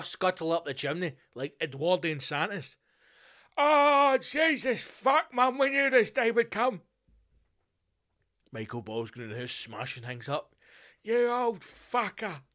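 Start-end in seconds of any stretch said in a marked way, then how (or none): 3.6-6.8 high, loud alarmed cry; panicked kid. Pretend. Children fleeing up the chimney, high and loud. 11.0-12.4 very slow, high growl; mock raging. [name] raging at Sir [name], slow and high.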